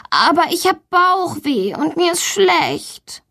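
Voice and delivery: high, slow, pitiful protest; insisting she is ill. A narrator's reading. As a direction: plead sickness in a drawn-out child's voice. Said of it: "behauptet Valentina". (leidend beteuernd, hoch und langsam)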